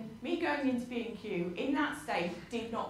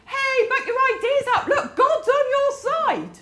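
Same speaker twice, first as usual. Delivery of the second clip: loud, much higher, laughing encouragement; hammed-up cheerleader egging him on; cheery pep talk; 13 semitones higher, 13 dB louder than usual. Friends cheering Elijah on, loud mocking pep. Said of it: "people with Elijah going".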